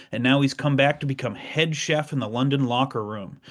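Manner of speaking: usual speaking voice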